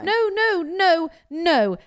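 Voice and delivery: loud, high protest to a friend who says she doesn't care; emphatic no. Real, not acted. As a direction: shut the idea down hard. Objecting to a friend who won't plan.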